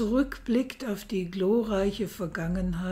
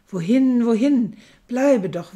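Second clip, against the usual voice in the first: pleading call; 3 semitones higher, 8 dB louder than usual. Acted. Emperor begs lover to stay.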